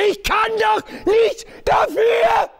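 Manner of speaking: high, slow, anguished yell; desperate shout. Acted. Cornered man shouting his defence at the crowd. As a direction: scream out your innocence.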